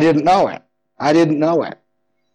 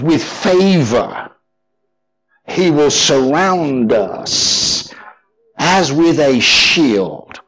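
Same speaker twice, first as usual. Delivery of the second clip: slow, solemn reading of the verse; solemn scripture reading; much slower than usual. Acted. Preacher reading Psalm five to the congregation, slow and solemn. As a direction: draw the verse out slowly and reverently.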